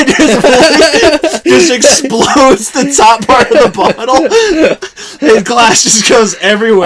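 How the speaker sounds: telling it through helpless laughter; high, loud, cracking up mid-story; laughing hard. Real, not acted. Friend telling of the exploding bottle, laughing hard.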